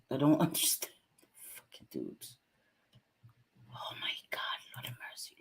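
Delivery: whispered under the breath, fed up; whispered exasperation at the trolls; exasperated whisper. Real, not acted. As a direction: hiss it to yourself in disbelief.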